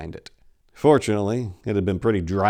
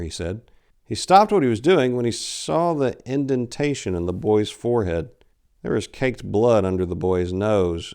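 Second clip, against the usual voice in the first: ordinary delivery, nothing marked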